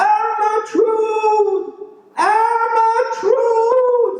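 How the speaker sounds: long, high sing-song call. A narrator's reading. Francie calls out for his lost cow, high and drawn out. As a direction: draw the name out up high, slowly. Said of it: "he was calling".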